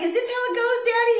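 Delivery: put-on high, sweet toddler voice; little boy voice. Mom imitates her toddler son asking his dad, high little boy voice. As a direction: ask it like an innocent small child. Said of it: "He's like".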